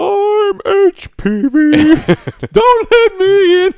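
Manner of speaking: very high, slow, hammed-up squeaky voice; mocking rapper voice. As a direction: stretch each word out in a silly falsetto. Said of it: "when he's like".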